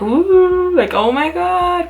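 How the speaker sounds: high, drawn-out teasing "ooh"; high, slow, exaggerated spooky alarm; mock freak-out. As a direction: imitate someone winding you up. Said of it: "doesn't try to trip me out".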